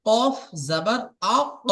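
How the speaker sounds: কফ জাবার আক drawn out slowly, আক caught at the back; slow recitation drill. Shown as a demonstration. Teacher slowly reciting the qaf spelling drill for learners. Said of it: "আপনি যদি উচ্চারণ করেন".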